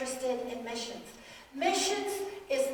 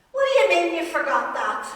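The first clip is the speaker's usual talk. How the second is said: mock bickering; 6 semitones higher, 10 dB louder than usual. Acted quarrel between aging spouses.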